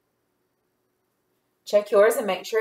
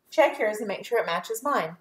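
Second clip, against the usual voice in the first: ordinary delivery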